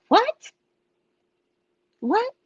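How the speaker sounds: high, baffled double take; mock baffled staff asking what. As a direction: squeak out a confused what. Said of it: "the people were like".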